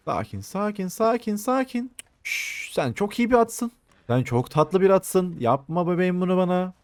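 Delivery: calm the frightened horse gently; soothing horse. Real, not acted. Calming a wild horse.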